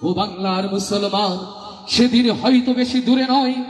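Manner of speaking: high, fervent oration; impassioned preaching. Acted. Preacher exhorting the Muslims of Bengal, fervent and high. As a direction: rouse the listeners with rising fervour.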